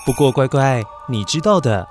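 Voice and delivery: ordinary delivery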